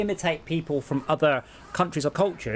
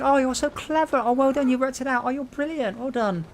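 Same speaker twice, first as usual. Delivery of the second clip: patronising praise; 10 semitones higher than usual. Pretend. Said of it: "are you gonna encourage it, going".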